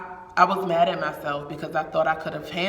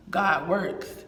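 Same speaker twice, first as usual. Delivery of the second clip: ordinary talk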